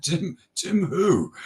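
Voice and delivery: slow, high mock disbelief; drawn-out Tim who, not buying it; incredulous. [name] doubting the caller on the phone, slow high mock disbelief.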